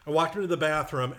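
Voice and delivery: ordinary talk, nothing marked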